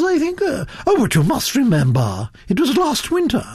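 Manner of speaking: high-pitched, swooping grande dame voice; opinionated Duchess, pitched well up; imperious gossiping Duchess. Acted. Duchess gossips about the girl.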